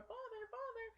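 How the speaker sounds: high-pitched imitation of a child calling out; mock-frantic cry at a locked door; calling for father. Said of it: "they're banging on the door".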